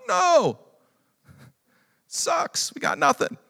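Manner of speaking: high, loud, exasperated complaint fading to a breathy mutter; fed-up fishermen. Acted. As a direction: grumble about the empty nets.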